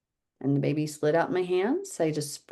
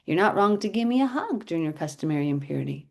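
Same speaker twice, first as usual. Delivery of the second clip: usual speaking voice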